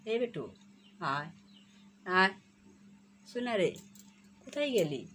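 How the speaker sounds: calling the pet. Real, not acted. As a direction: coax the bird out of hiding. Calling the hidden bird.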